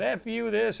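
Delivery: high, heated quoted outburst; mock angry yell. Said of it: "trade of languages going back".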